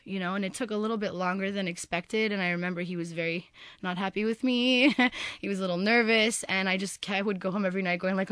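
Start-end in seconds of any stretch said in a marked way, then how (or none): none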